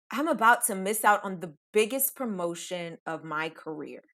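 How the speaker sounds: ordinary talk, nothing marked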